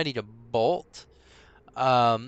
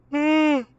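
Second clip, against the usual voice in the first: yawning through the words; 12 semitones higher, 6 dB louder than usual. Real, not acted. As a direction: speak mid-yawn. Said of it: "Sorry about the yawn".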